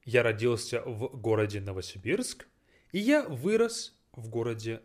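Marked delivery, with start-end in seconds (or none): none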